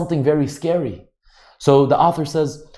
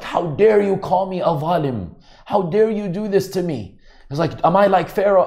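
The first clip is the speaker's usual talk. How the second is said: higher, indignant retelling of his protest; imitating an offended abuser, higher and indignant; retold offended outburst; 6 semitones higher than usual. Preacher retells the offended husband's protest, mildly indignant and higher. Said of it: "the person was so offended. He was so upset".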